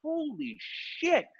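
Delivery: stretched-out hushed amazement; stunned disbelief. Retold or imitated. Masked onlookers silently reacting, stunned and drawn out. Muffled reaction behind masks, retold.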